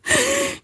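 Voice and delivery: high wheezing laugh; helpless laugh. Real, not acted. Streamer cracking up over the gossip, helpless laughing. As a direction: crack up until it squeals.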